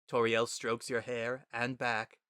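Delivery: usual speaking voice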